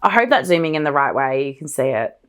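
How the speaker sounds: ordinary delivery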